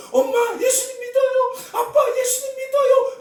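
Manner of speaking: high, slow, crying plea to her parents; dying daughter begging mom and dad, crying high; tearful plea. Retold or imitated. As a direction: voice breaking as she begs them to believe. Dying girl's inner cry voiced.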